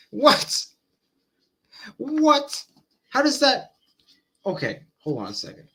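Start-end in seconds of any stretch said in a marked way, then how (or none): 0.0-3.7 sharp, rising cries of disbelief; a loud, high-pitched "what" of real bewilderment; baffled. Real, not acted. Baffled reaction to rapid definition.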